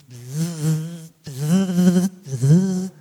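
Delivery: a mock wasp buzz that rises to loud; imitated buzzing. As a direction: buzz like an angry swarm of wasps. Imitating wasps in the hole.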